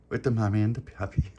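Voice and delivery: usual speaking voice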